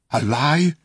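sharp, fierce retort. A narrator's reading. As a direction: fling the question back hotly. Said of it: "said the king fiercely".

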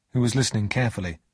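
ordinary delivery, nothing marked

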